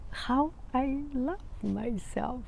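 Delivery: whispered through laughter; soft whispered catchphrase. Real, not acted. Signing off to viewers, whispered and giggling. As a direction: breathe it out, still giggling.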